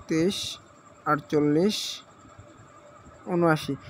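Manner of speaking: one number at a time, long gaps between; halting, paused dictation of digits; reading while typing. Real, not acted. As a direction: spell the code out piece by piece as you key it in. Says code while typing it in.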